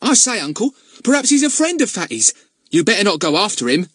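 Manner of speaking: put-on high, loud excited young lad; excited boy voice. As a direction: blurt it out like an eager boy. Narrator voices Ern.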